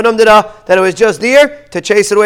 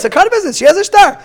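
indignant complaint; 9 semitones higher, faster than usual. Retold or imitated. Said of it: "Amalei said".

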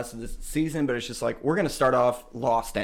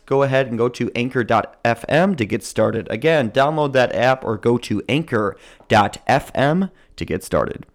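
louder, steady sponsor read; 5 dB louder than usual. Acted. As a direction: sell it like a radio spot. Reads sponsored ad.

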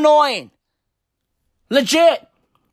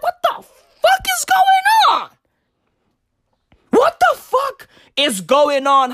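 much higher, exasperated yell; exasperated shout; 14 semitones higher than usual. Retold or imitated. Comic ranting at oat milk drinkers, shouted disbelief. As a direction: cry out in disbelief. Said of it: "I'm like".

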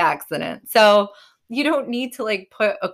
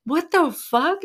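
high, incredulous husband, mildly mimicked; a jump up in pitch as he sees the price; shocked husband; 10 semitones higher, slower than usual. Ryan looks at it and he's like.

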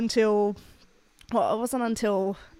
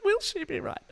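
mock hopeful question; 13 semitones higher than usual. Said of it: "I was like".

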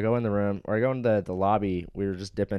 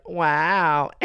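higher, louder imitation of the clerk; clerk's affronted reaction; 6 semitones higher, 5 dB louder than usual. Guest imitates insulted clerk on the phone, affronted. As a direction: mimic a drawn-out, affronted response. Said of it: "she's like".

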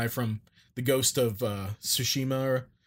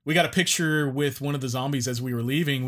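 normal talking voice

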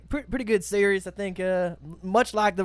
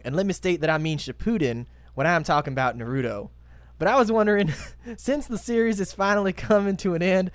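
put-on Texas accent; 3 semitones higher than usual. Podcast host reads a fan letter in a mock Texas accent. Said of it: "read this one in my Texas accent".

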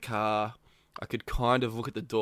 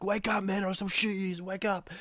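mock threatening captor; 7 semitones higher, 6 dB quieter than usual. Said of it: "He's like".

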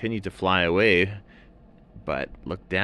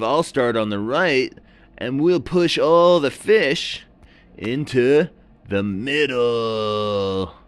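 high, loud surfer plan; surfer seal; 7 semitones higher, 7 dB louder, slower than usual. A narrator's reading. Seal laying out the fishing plan, loud surfer voice. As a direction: pitch the plan like a pumped surfer. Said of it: "And the seal said".

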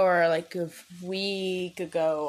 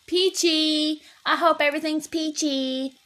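chirpy peachy; 7 semitones higher, 4 dB louder than usual. Real, not acted. Correcting herself to a chirpy greeting.

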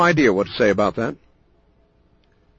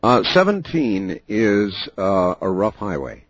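ominous late night radio; 7 semitones lower than usual. Acted. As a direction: brood darkly into the mic. Host's ominous late night monologue.

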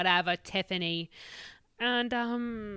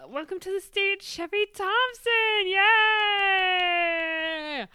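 announcer; 9 semitones higher, 4 dB louder than usual. Acted. Host introduces the comedian.

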